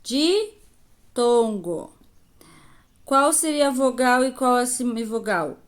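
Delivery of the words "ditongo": ditongo stretched out slowly, each syllable held; drawn-out answer. Shown as a demonstration. Teacher reveals the answer to the class, slowly stretched. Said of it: "Então, eu chamo de".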